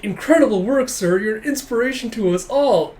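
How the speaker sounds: effusive fawning, played up; gushing flattery. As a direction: lay on hammed-up flattery for the boss. Acted flattering of a superior.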